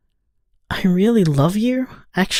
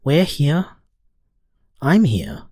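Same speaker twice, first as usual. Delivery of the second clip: ordinary delivery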